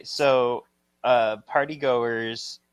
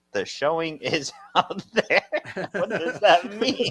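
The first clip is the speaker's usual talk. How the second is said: laughing; 5 semitones higher than usual. Acted.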